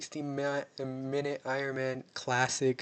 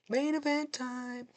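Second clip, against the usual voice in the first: announcer call; 11 semitones higher, slower than usual. Real, not acted. Announcing the main event.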